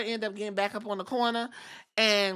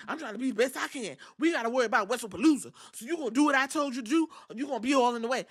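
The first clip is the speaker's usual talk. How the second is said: Jay ranting; 3 semitones higher, faster than usual. Retold or imitated. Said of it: "And Jay was like".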